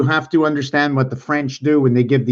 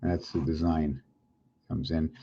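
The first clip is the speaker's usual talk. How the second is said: very soft, low self-talk; murmuring to self; 8 semitones lower, 12 dB quieter than usual. Real, not acted. Presenter looking over their own design, murmuring to self. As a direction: say it low, only for yourself.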